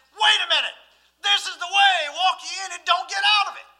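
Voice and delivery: loud, high warning cry; inner voice calling out. Retold or imitated. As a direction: call out like a voice stopping you in your tracks. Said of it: "that voice back there in the back of your head saying".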